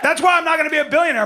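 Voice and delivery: high, loud and fast; shouted punchline. Real, not acted. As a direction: blurt it out.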